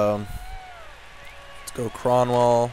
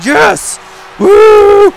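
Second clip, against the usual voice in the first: much higher, louder cheer; real goal celebration; 14 dB louder than usual. Real, not acted. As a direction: scream with joy at the goal. Finally scores a goal.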